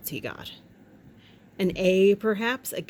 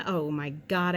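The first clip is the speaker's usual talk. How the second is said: normal talking voice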